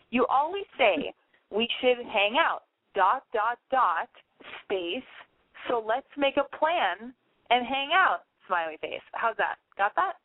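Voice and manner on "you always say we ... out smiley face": slow, spelled-out dictation. Shown as a demonstration. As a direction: dictate it slowly, word by word. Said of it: "keep that".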